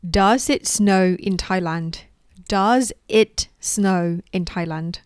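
very slow, clear teacher model; slow model question. Shown as a demonstration. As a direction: say it slowly so they repeat. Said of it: "How about this one?". (พูดช้าๆ ชัดๆ)

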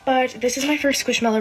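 winded from running downstairs; real breathlessness after a run; out of breath. Real, not acted. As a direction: talk while catching your breath. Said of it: "I'm really out of breath".

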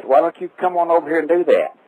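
ordinary talk

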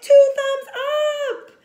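high, loud and slow; enthusiastic. Real, not acted. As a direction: cheer it out. Enthusiastic sign-off.

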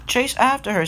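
high eager shout; high urging voice. Acted. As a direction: urge him on, pitched high. Mario urging Edward.

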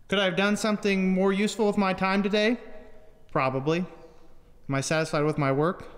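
usual speaking voice

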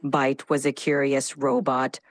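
low, dramatic robot voice. Acted. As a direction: speak like a dramatic machine. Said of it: "in a robotic voice".

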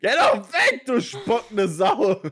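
yelling at an enemy, drawn out; furious shout at a spitting monster; angry yelling. Real, not acted.

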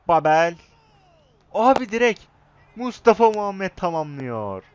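high, loud goal yell; goal celebration yell. Real, not acted. Gamer celebrating his team's goal, excited high yell. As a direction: scream the goal like a fan.

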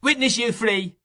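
put-on high-pitched, excited boy's cry; worked-up child. A narrator's reading. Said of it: "cried the boy".

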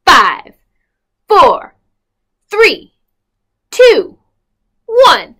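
loud countdown, each number punched; called countdown. Acted. Storyteller counts down loudly for the children. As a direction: call out the count like a launch.

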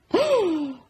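high, loud gasp; shocked gasp. Acted. Baker seeing the milk spilt, shocked gasp. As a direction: gasp sharply in alarm.

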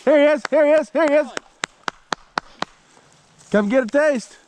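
high, loud, excited praise for the dogs; high, excited call to the hunting dogs. Real, not acted. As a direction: whoop and call them in, thrilled.

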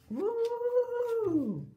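very high, hooting questioning woo; owl hoot. Acted. Teacher voicing the owl in a picture book, high hooting question. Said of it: "asked the owl".

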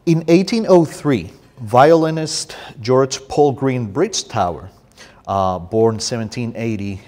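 emphatic, declaimed introduction; formal reading aloud. Acted. Poet reads book introduction to audience, emphatic formal reading.

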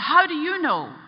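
high, loud, startled question; retold shocked demand; astonished. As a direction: blurt it out in disbelief. Man stunned the priest knows.

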